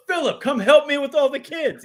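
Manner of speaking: high-pitched mock wife calling out; yelling wife. Wife yells to Philip for help with the kids, high mock yell. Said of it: "His wife yells".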